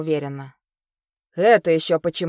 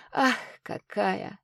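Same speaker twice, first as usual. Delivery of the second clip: softer, slower dreamy repeat; soft echo; 3 semitones higher, 5 dB quieter, much slower than usual. Acted. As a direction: sigh «Ах, какая!» softly and slowly to yourself. Тихий повтор восклицания. (тихое мечтательное эхо)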